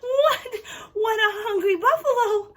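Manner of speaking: very high, loud squealing delight; breathless play-acted amazement; hammed-up excitement. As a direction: squeal and gush over the greedy pretend animal. Pretend buffalo gobbles, then leaves.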